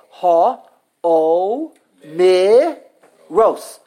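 ha O mi ros, each syllable apart and punched; split into syllables. Shown as a demonstration. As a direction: count out ha, O, mi, ros one by one. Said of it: "Four syllables".

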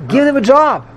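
mock eager advice. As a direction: sell the job offer with a wink. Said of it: "He said".

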